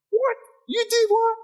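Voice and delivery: very high-pitched mock outrage; hammed-up, high, incredulous shriek; outraged knee-jerk reaction. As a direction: squeal it in exaggerated disbelief. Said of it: "sometimes we react to the scratch".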